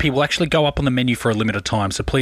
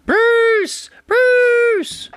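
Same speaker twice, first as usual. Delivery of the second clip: very high, loud falsetto yell; lady screaming; 4 dB louder than usual. Retold or imitated. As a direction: imitate a woman shrieking for her dog. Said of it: "He hears a lady screaming".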